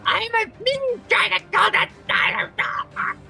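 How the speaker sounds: very high, slow sulky boast; squeaky pouting kobold. Acted. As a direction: stomp and sulk as a kobold. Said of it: "He goes".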